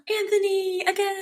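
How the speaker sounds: very high fan squeal after a gasp; gasp, then gush way up high; gasping delight. Retold or imitated. Acts own thrilled reaction to actor.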